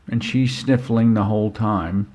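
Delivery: usual speaking voice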